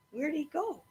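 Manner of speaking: usual speaking voice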